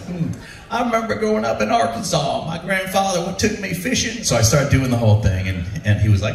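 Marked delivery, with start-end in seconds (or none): 0.1-4.2 higher, soft Clinton reminiscing; Bill Clinton impression. Impersonating Clinton to the president himself, folksy and soft. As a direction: croon it like Clinton telling a folksy memory. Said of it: "my presentation of you".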